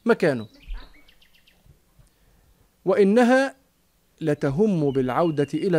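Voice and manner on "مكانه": quick resolve. Acted. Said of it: "خلاص، قالت".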